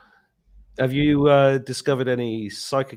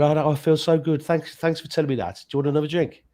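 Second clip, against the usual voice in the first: higher, louder mock relieved friend; imitating grateful friend; 5 semitones higher, 4 dB louder than usual. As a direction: play the friend's happy thanks. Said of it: "And she went".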